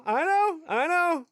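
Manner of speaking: high-pitched, loud agreement; exasperated conceding. Real, not acted. Conceding an objection to own suggestion.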